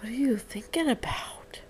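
soft, voiceless whispered question. Real, not acted. Mother asking son his thoughts, playful whisper.

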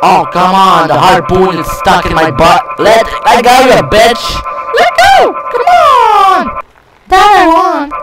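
loud, high pleading at the game; frustrated yelling. Real, not acted. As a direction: yell in mounting frustration, rising to a squeal. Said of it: "yelling in the microphone".

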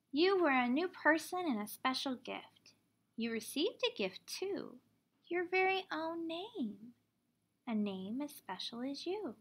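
ordinary talk, nothing marked

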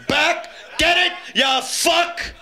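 high yelled back get it; shouted punchline. Pretend. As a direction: yell it up high at the leaver.

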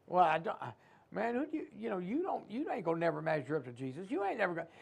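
mock doubter trailing off quiet; doubting mumble. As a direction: fumble for excuses under the breath. Voicing doubting believer.

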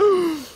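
sharp high gasp of surprise. Real, not acted. Gamer gasping at his luck, high and sharp. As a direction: gasp in disbelief. Lucky drop in game.

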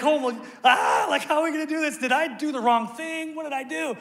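high, desperate shouting prayer; anguished crying out. Retold or imitated. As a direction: shout it up to God in panic. Retold crying out to God.

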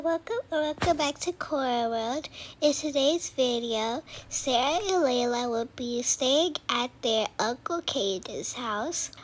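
usual speaking voice

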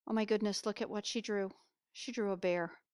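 ordinary delivery

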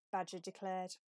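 ordinary delivery, nothing marked

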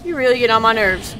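loud, exasperated shout; annoyed shout. Real, not acted. Gamer fed up with the chasing woman, loud exasperated shout. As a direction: vent your irritation at her.

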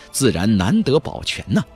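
ordinary delivery, nothing marked

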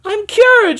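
high, loud cry of joy; joyful shout. Acted. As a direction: shout it triumphantly. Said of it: "she shouted".

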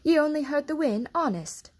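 jittery high maid insisting; nervous Martha. A narrator's reading. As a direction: gabble the excuse nervously. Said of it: "cried Martha, looking scared".

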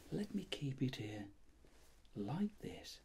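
normal talking voice

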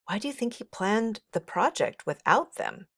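usual speaking voice

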